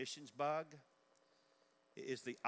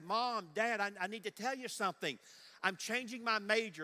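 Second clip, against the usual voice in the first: eager student; 9 semitones higher, 6 dB louder than usual. Retold or imitated. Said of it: "they say".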